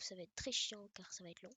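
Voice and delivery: whispered grumble, voice dropped; bored whisper to the viewers; whispered aside. Real, not acted. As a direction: mutter the complaint under your breath. Bored aside about cooking.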